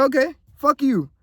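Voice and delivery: high, dismissive comeback; mock defiant clapback; defiant retort. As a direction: spit it back at the doubters. Retorting to those calling him lazy.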